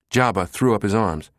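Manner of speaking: usual speaking voice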